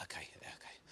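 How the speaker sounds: whispered, low and hopeless; despairing whisper. Retold or imitated. Man at a Zoom assessment, whispering in despair. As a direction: breathe it out defeated. Resigned to spelling test.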